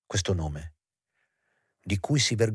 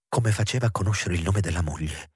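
usual speaking voice

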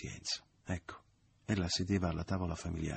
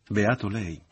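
loud, biting sarcasm; a sharp, resentful jab; sarcastic; 4 semitones higher, 11 dB louder, slower than usual. A narrator's reading. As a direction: throw the words back at him mockingly. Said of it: "ripeté la madre sarcastica".

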